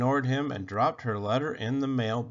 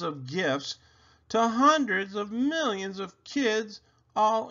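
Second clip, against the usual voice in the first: lightly voiced boy, higher, laying out his proof; a hint of a smug boy listing facts; know-it-all big brother; 7 semitones higher than usual. A narrator's reading. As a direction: argue it like a smug little lecturer. Alfie voiced arguing his proof.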